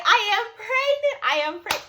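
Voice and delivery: high-pitched thrilled announcement; re-enacted giddy exclaiming; excited. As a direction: squeal the news, reliving it. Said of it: "I was so excited".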